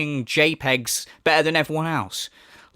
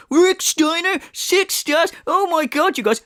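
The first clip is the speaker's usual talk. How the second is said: high, squealing parody of his glee; hammed-up impression of the streamer thrilled by his pull; mocking giddy streamer; 12 semitones higher, slower than usual. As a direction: mimic an overexcited fanboy, much higher. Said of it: "He's there going".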